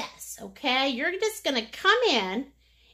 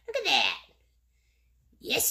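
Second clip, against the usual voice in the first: ordinary talk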